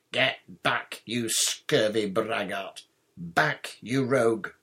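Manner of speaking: loud, blustering knight's threats; raging knight. A narrator's reading. Fallen knight warns boy off from the picture, raging. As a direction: bark each insult with pompous fury. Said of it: "The knight's rage".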